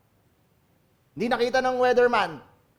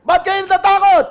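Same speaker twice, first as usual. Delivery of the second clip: high, loud shout; shouting over the waves; 11 semitones higher, 13 dB louder than usual. Shown as a demonstration. Jesus shouting over loud waves to the disciples, high shout. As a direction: yell it over the roaring storm. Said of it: "Hindi siya marinig".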